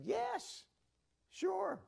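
high, bright-eyed yes from Peter; eager Peter. Acted. Peter answers Jesus, voiced.

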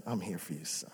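dad hugging his son; tender reassurance. Retold or imitated. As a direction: comfort him gently, almost a murmur. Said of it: "And I embraced him and said".

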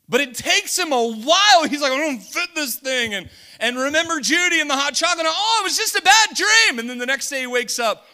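loud, high preacher's retelling; preaching at full force. Acted. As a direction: shout the story out to the church.

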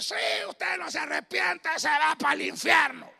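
loud hellfire street-corner yell; street preacher shouting. Retold or imitated. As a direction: shout damnation at passersby.

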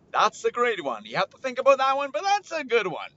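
high, loud delighted gush; excited. Real, not acted. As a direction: squeal over your own joke. Laughing at own joke.

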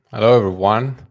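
ordinary delivery

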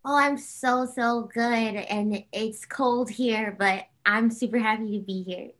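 usual speaking voice

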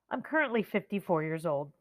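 normal talking voice

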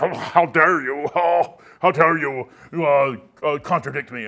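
slow, huffing imitation of an offended professor; mock indignation. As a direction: draw out the "oh" in mild, hammed-up outrage. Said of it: "he wasn't real, real mad, but he was, he was like".

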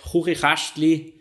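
„Chuchichastli“ langsam mit rauem Ch gesprochen; Schweizerdeutsch vorgesprochen. Shown as a demonstration. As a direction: Zungenbrecher sorgfältig vorsprechen. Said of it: "der schwierigste sein soll auf Schweizerdeutsch". (schwieriges Schweizer Wort langsam gesagt)

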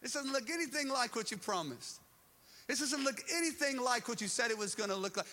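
high, loud, exasperated complaint; acted-out protest to God; indignant protest. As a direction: push back as if cheated of a promise. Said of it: "and you're going".

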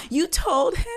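high-pitched incredulous question; mock outraged disbelief. Spike finding out he was told, mock outraged disbelief. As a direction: pitch it up as if betrayed.

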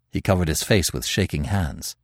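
plain reading voice

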